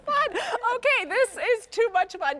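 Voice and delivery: high, laughing delight; laughing excitedly. Real, not acted. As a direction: squeal with giddy fun. Laughing after trying duck call.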